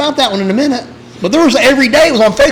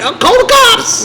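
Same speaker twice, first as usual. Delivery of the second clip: mock panicked homeowner. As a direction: shriek it like a scared suburbanite.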